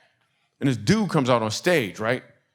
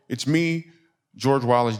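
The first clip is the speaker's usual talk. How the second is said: ordinary talk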